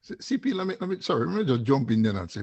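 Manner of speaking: normal talking voice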